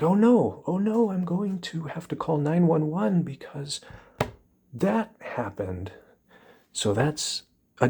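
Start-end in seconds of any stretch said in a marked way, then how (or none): 0.0-5.9 high, loud panicked oh no, then slowing; a person overreacting to a tiny sensation, mock panic; mock overreaction.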